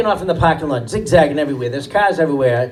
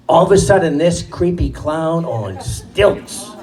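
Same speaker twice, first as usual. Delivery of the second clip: ordinary talk, nothing marked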